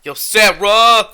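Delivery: loud, high, drawn-out holler; mock calling out. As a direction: holler the name as if across a room. Pretend calling out to Sarah.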